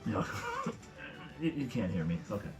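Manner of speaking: ordinary delivery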